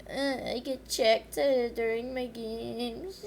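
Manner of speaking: slow, whiny mock complaint about getting checked; mocking whiny girl. Mimicking the spoiled girl's complaint, slow mock whine. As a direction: whine it like a spoiled kid fishing for pity. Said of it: "sometimes she's like".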